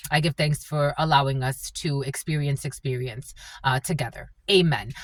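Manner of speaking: low, soft prayer of thanks. Real, not acted. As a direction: close the prayer quietly and low. Closes opening prayer.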